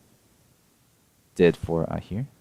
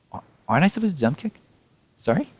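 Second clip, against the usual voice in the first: confused; 9 semitones higher, faster than usual. Real, not acted. Confused by game mechanics.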